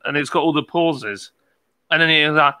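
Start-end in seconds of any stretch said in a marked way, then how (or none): none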